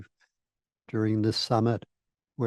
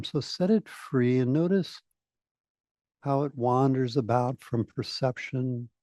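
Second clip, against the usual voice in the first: guided meditation. Real, not acted. Speaker settling a summit audience into rest, guided meditation. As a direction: ease listeners gently into awareness, unhurried.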